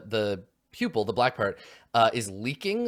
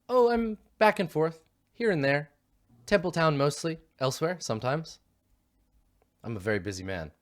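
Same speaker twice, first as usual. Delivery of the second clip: busy doctor; 5 semitones higher than usual. Acted. Doctor boasting of busy schedule.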